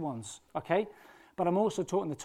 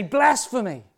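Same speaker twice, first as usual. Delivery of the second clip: accusing shout; 5 semitones higher, 10 dB louder, slower than usual. Acted. Crowd accuses Jesus of blasphemy.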